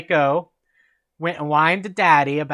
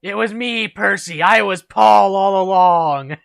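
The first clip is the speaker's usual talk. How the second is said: mock villain reveal; 4 semitones higher than usual. Stepdad Paul unmasks himself to Percy, mock villain gloat. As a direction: build to a big, drawn-out villain unmasking.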